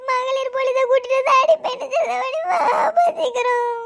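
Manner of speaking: put-on scared child begging father on the phone, crying; crying plea. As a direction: beg through tears to come quickly.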